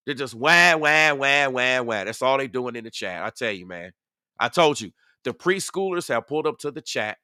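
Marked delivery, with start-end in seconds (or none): 0.3-2.0 put-on drawn-out, half-hearted baby wail; mock crying. Streamer mocking upset people in the chat, mock crying. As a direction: mimic crybabies with spoken wahs. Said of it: "and they're crying".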